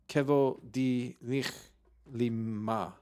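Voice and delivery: very slow, drawn out; slow syllable reading. Shown as a demonstration. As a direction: stretch every syllable. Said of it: "five removed".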